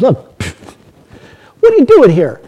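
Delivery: loud, jeering thief on the cross, with a scoffing laugh; mocking criminal. The mocking criminal jeers at Jesus from his cross, loud and scornful. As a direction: taunt him, loud and scornful. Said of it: "The other guy looked at him... and said".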